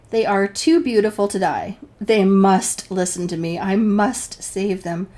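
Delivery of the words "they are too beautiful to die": ordinary talk, nothing marked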